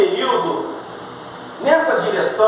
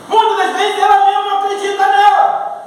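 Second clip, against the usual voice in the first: high, raised preacher's voice; emphatic preaching; 8 semitones higher than usual. Real, not acted. Preacher repeating his point to the church, emphatic. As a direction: drive the point home at a high, sustained pitch.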